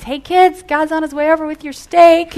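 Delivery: retold yell. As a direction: call it across the room to the kids. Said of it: "he yells to the kids".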